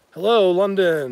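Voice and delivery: loud, high, drawn-out hello; calling out greeting. Real, not acted. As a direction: greet a faraway viewer with a big stretched call. Calling out to a London viewer.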